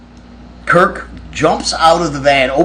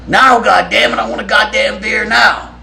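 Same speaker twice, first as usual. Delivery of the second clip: angry demand; 8 semitones higher than usual. Retold or imitated. Drunk bandmate demanding beer from the cashiers, mock furious shout.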